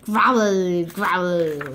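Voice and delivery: usual speaking voice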